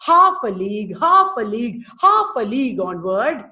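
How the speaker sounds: HALF a league, each "Half" hit loud; march it out, punching every "half". Shown as a demonstration. Teacher showing fast-moving dactyls, marching beat. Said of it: "it is like marching".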